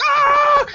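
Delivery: high mock yelp; hammed-up short cry; mock scream. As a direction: yelp high like diving for cover. Characters hitting the ground.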